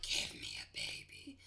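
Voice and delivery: deep, slow, whispered demand; creepy uterus voice. Pretend. Voicing her uterus to her cohost, deep slow whisper. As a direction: growl it like a hungry monster. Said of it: "What was that?".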